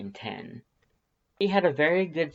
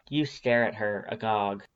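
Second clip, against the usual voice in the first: ordinary delivery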